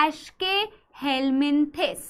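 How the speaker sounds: "एस्केहेलमिन्थिस" softly, broken into parts; teacher sounding out "एस्केहेलमिन्थिस"; slow model pronunciation. Shown as a demonstration. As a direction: say "एस्केहेलमिन्थिस" slowly, syllable groups apart. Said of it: "जिसे बोला जाता है".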